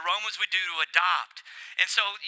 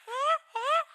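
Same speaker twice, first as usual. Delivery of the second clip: much higher cheering whoops from the angels; mock angel cheer. Preacher voicing angels cheering over a child, high whoops.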